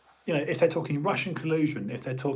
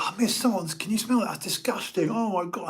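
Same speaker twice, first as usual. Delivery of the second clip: higher, hammed-up tattling pupil; mock outraged pupil; 7 semitones higher than usual. Pupil with hand raised complaining to the teacher, mock outrage. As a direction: tell on the smell to teacher. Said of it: "I'd raise my hand and go".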